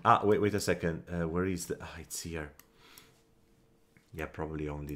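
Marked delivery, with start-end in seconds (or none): 0.0-1.0 loud startled wait, wait; jolted call to hold on; sudden wait. Real, not acted. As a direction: stop yourself short. Sudden halt while playing.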